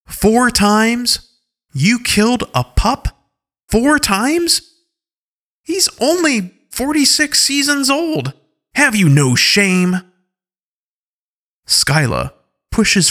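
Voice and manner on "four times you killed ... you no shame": much higher, outraged shouting; angry. Acted. Alpha Paw outraged that a pup was killed four times, angrily. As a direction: rage at them for killing a pup. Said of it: "in a more angry voice".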